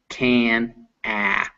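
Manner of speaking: normal talking voice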